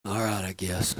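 quiet, low resigned answer; dejected. Retold or imitated. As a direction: sound worn out and beaten. Dejected friend on the phone.

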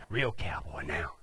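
slow, hushed whisper; whispered aside. Real, not acted. Storyteller confiding about being on horseback, whispered aside. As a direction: confide it under the breath.

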